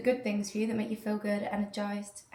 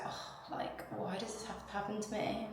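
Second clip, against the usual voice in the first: quiet self-pity; 11 dB quieter than usual. Retold or imitated. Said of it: "I'm just like".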